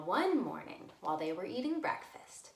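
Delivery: usual speaking voice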